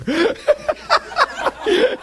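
high, real burst of laughter; helpless laughter. Real, not acted. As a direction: crack up at your own story. Cracks up at own story.

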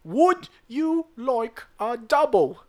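high, loud, slow repeat in mock barmaid accent; mock British bartender, slowed. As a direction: drag the question out slowly, loud and high, British. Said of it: "she goes".

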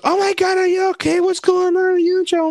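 very high, loud mimicked fussing; frantic concern. Friends replying to cop-car photo, frantic concern. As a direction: shriek the questions in mock alarm. Acted alarmed friends' replies.

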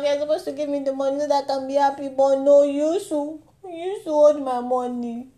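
ordinary talk, nothing marked

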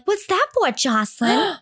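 high-pitched feigned curiosity; playing along. Relatives teasing a little girl about her gift, feigned puzzlement. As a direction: ask a child with put-on innocence. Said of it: "Everyone is so in on the joke".

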